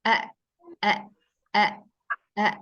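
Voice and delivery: "E" cut short with no flow of breath; sukun on hamzah. Shown as a demonstration. Teacher showing that hamzah does not flow, abruptly stopped "e". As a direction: stop each "e" dead in the throat, repeated separately.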